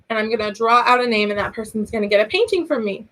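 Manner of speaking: normal talking voice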